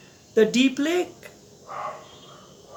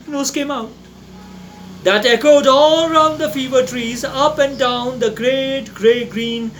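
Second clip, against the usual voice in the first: ordinary delivery, nothing marked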